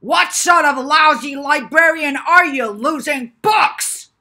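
loud, high, slow on losing books; furious shout. Acted. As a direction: scream the insult in rage. Professor yells at librarian.

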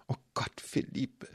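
breathless hushed whisper of his name; whispered relief. A narrator's reading. Tied up man whispering to his boyfriend, hushed relief. Bound, seeing boyfriend Felipe arrive.

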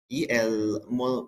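ordinary talk

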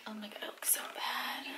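very soft whispered aside; whispering. Real, not acted. Mother whispers about the bad picture. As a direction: breathe it out, almost silent. Whispering beside resting baby.

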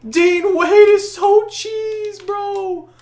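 high, drawn-out excited cry; hyped exclaiming. Real, not acted. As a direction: stretch it out in amazed delight. Celebrating a made three.

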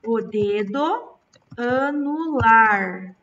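slow teacher emphasis on anular; slow, drawn out. Shown as a demonstration. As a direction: spell it out for the kids, syllable by syllable. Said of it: "qual dedo está ao lado do dedo mínimo?".

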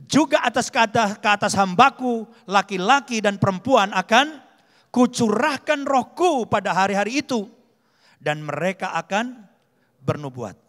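loud, emphatic Bible reading; scripture proclamation. A narrator's reading. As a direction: proclaim the verse to the congregation. Reads scripture aloud with force. (membacakan firman dengan lantang)

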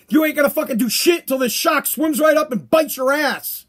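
usual speaking voice

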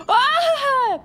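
sharp gasp, then loud high "ah!"; startled gasp and cry. Real, not acted. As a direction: gasp into a piercing held cry. Sudden outburst cuts off her talk.

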